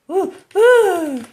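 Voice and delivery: very high, loud whoops; excited whooping. Real, not acted. Player whooping during a chase, excited.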